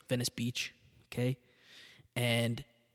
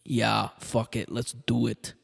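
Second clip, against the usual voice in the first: put-on slow, shrugging Austrian accent; slower than usual. Arnold agreeing to go into politics, in a slow Austrian accent. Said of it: "The accent got worse".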